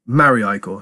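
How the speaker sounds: plain reading voice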